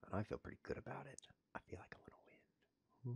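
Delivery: fast, soft whisper; hushed, quick aside. Real, not acted. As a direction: whisper it to yourself. Whispering to himself.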